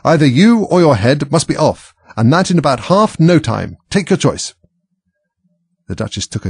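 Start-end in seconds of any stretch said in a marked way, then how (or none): 0.0-4.5 high, loud Queen's command; furious royal ultimatum, pitched up; shouted ultimatum. A narrator's reading. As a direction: lay down the threat at full voice. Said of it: "shouted the Queen".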